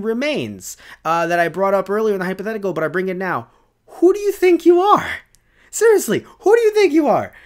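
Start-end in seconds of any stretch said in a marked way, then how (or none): 3.9-5.1 high-pitched, loud outburst; indignant shout. Real, not acted. YouTuber challenging the hackers, indignant shout. 5.7-7.3 high-pitched, loud outburst; indignant shout. Real, not acted. YouTuber challenging the hackers, indignant shout. Outraged rhetorical challenge, repeated.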